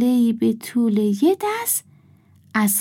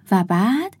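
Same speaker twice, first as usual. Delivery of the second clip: plain reading voice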